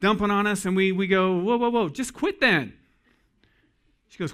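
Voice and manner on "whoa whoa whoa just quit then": high-pitched, cutting in to halt her; mock alarm, pitched well up; interrupting husband. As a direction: put on an exasperated quick-fix husband. Acting husband cutting in with fix.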